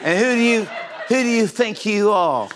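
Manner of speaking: high, indignant English character; British accent. Acted. Host's only line as British character, high indignant accent. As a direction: challenge them in a high put-on British accent. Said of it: "That's all my character says".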